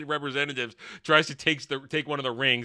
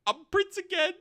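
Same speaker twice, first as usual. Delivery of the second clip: very high-pitched silly voice; mock princely bleat. Durin telling his wife, high mock bleat. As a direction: squeak it out in mimicry.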